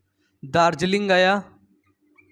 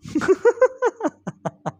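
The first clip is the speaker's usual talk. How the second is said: burst of laughter; 13 semitones higher than usual. Real, not acted. Laughing at the brother's comeback.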